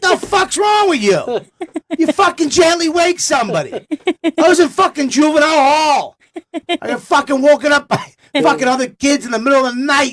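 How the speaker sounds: high, loud, worked-up rant; lecturing his new girlfriend in outrage; hammed-up high-pitched scolding; indignant rant.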